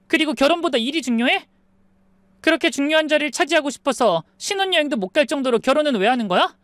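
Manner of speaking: high, pressing complaint to fiancé; upset bride. Acted. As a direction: confront him, hurt and indignant. Bride confronting fiancé over honeymoon.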